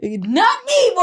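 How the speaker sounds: high, loud brush-off; sassy refusal. Retold or imitated. Turning down the knuckleheads, high sassy no. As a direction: flick it away high and loud.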